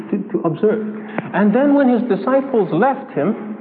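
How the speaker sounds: normal talking voice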